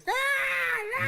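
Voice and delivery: very high shrieking "Ah, ah" like a big cat; mock mountain lion scream. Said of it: "like something screaming".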